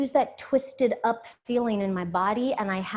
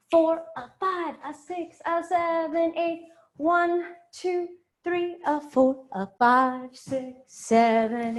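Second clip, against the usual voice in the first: counting the steps out in sung rhythm, rising; rhythmic dance count; 5 semitones higher, slower than usual. Acted. Dance teacher counting the class through the steps in rhythm. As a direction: call the beats to the class.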